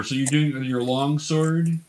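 ordinary talk

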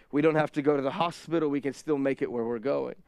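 normal talking voice

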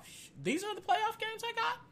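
ordinary talk

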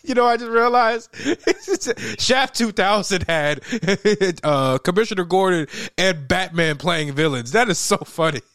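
laughing hard through the words; can barely get it out for laughing. Real, not acted. Laughing at villain casting.

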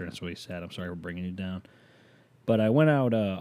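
ordinary delivery

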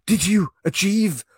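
high, loud, slow pompous nobleman voice; overdone aristocrat addressing his dear wife; hammed-up posh husband. Husband asks his wife about the night, in a loud, high, pompous voice. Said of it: "This is hurting my throat".

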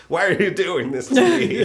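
high, loud pleading cry; whiny pleading Eliza. Retold or imitated. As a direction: plead like a wronged girl. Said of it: "where she's like".

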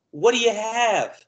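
high, slow, playing dumb with a laugh; innocent. Ref asks partner the call, innocently, high and laughing. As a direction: ask sweetly as if you know nothing. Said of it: "I'm very innocent".

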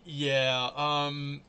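normal talking voice

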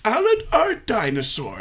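a hint of a scornful rich boy calling him out; Harry Osborn impression. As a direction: call the name out slowly and high, a mock Spider-Man villain. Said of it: "it reminds me of in Spy-, Harry Osborn".

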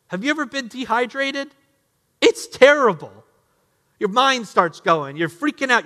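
a fiery pulpit appeal; impassioned preaching. Acted. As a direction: drive home their suffering. Preaching hardship at full force.

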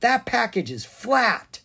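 put-on high, slow, loud little girl; young girl's voice. A narrator's reading. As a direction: announce it like a girl, pitched well up. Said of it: "the voice of a young girl".

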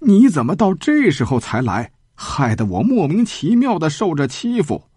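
lightly acted indignant outcry; clamouring complaint. A narrator's reading. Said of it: "就嚷嚷起来说". (微微提高嗓门地嚷嚷)

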